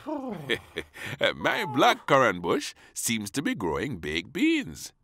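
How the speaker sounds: slow, lightly voiced puzzled gardener; a puzzled old gardener. Gardener at the door, lightly puzzled. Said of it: "said the gardener".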